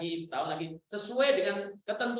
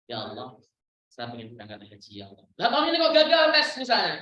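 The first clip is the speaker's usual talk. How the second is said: prayer then complaint; 6 semitones lower, 4 dB louder than usual. Retold or imitated. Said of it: "kan jadi mintanya enak".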